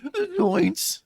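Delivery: high but unpolished Shaggy; quick first Shaggy attempt; rough Shaggy try. Shown as a demonstration. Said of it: "first take before retake".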